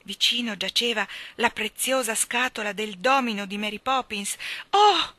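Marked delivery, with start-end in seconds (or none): 4.7-5.2 high, loud startled "oh"; astonished exclamation, pitched high; gasped surprise. A narrator's reading. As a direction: let out a surprised cry.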